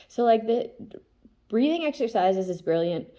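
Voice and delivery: usual speaking voice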